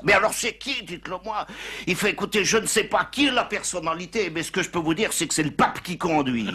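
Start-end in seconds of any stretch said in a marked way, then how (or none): 0.1-1.5 much higher, pressing then sing-song; pleading chief. Acted. Police chief on the phone begging to know who it is. 2.1-6.5 punchline. Acted. Punchline delivered to chief.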